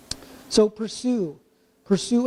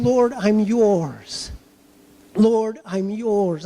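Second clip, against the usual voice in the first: slow prayerful confession; much slower than usual. Acted. Prayer voiced as believer's confession.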